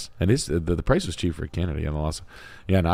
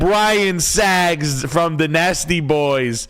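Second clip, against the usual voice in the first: much higher, slow hammed-up New Jersey guy; filthy Jersey boy voice; 9 semitones higher, much slower than usual. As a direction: stretch it out like a sleazy New Jersey dude. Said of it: "in your best filthy P O S Jersey boy voice".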